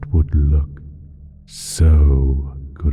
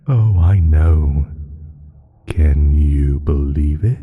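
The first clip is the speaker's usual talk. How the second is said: usual speaking voice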